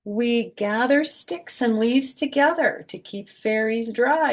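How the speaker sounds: lilting rhyme, slowing at the end; reading to children. A narrator's reading. Grandma reading fairy page to kids, lilting. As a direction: ease into the last word. Reads book text.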